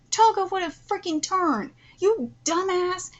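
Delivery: high, heated ranting; worked up, driving the point home; vindicated angry rant. Real, not acted. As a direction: scold the character with exasperation. Ranting that Toga proved the point.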